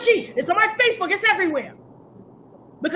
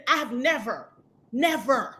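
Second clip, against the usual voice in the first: ordinary talk, nothing marked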